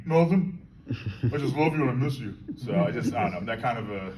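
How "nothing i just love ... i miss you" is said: higher, louder, playing the dog's innocent denial; the dog protesting its love; a dog pleading innocence to its owner. Voicing the dog's innocent reply.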